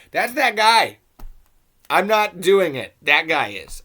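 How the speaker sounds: high, loud, insistent denial; loud protest. Pretend. Reviewer blaming that guy for the accent, loud protesting denial. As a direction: push back emphatically, punching each short sentence. Mock defence against accused offensive accent.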